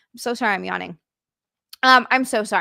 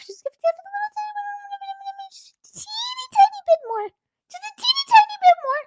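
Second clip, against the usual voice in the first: very high, tiny cartoon voice, a touch faster at the end; tiny high squeaky voice pleading for more caffeine; squeaky baby voice. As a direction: squeak it like a little cartoon critter. Baby talk about her own caffeine.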